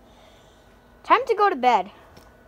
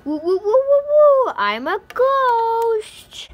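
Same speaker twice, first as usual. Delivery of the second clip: higher, louder, slow spooky wail; ghost voice; 6 semitones higher, 5 dB louder, slower than usual. Acted. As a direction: scare them with a ghost moan. Prank with ghost voice.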